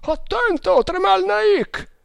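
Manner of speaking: very high cry, last word stretched; shouted warning. A narrator's reading. Oarsman warning his leader of danger, very high shout. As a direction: scream out the warning to save him. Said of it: "gridò uno dei remiganti".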